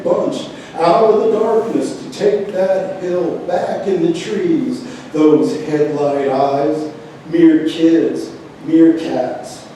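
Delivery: rhythmic, incantatory verse with firm beats; poem reading. Acted. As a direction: declaim the wordplay in measured phrases. Reads a poem aloud.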